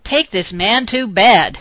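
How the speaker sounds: put-on the drunken brain shouting its order; slow, loud tipsy inner cry; joyous shout. A narrator's reading. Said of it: "silently shouted her drunken brain joyously".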